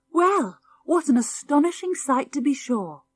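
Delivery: high, loud astonished exclaim. Acted. Old grandad marvelling at the dogs, astonished. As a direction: marvel at the strange sight.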